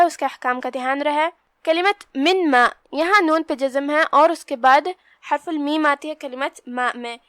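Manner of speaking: usual speaking voice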